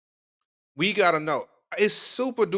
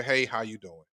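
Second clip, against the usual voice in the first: soft, lower smooth hello; smooth greeting demo; 6 semitones lower, 5 dB quieter than usual. Shown as a demonstration. Host demonstrates the greeting to guys, soft and smooth. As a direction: show him how easy the greeting is. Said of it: "Just walk up to her and say".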